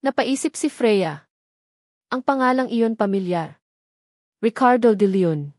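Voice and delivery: ordinary delivery, nothing marked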